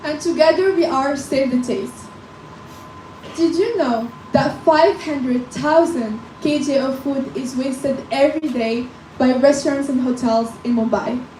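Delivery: normal talking voice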